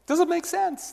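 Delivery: an airy, high, non-threatening ask; light question. Shown as a demonstration. Demos the simple question.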